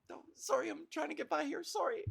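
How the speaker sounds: high-pitched mock-polite excuse; apologetic passer-by. Canadian trying to get past a sorry fest, mock apologetic. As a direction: play someone meekly squeezing by, voice lifted. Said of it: "you gotta go".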